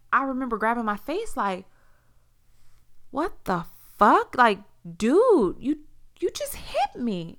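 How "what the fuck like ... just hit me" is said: slow, higher and louder, stunned disbelief; reliving the shock of being slapped; a stunned what-the-hell to the boyfriend who just hit her. Retold or imitated. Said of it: "And I'm shocked".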